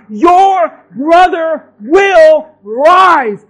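slow, loud proclamation; booming promise; slow shout. Acted. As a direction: stretch each word out, shouting. Jesus' words preached.

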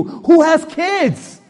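high, loud, slow question flung at the room; calling out to the crowd. Real, not acted. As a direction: ask the whole church, drawn out. Preacher asks congregation loudly.

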